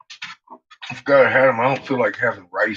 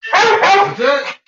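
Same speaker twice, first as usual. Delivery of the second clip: sharp high call, no more treats; loud high That's it; 10 dB louder than usual. Real, not acted. Owner tells begging dogs treats are done, loud high. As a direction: shout it to end feeding.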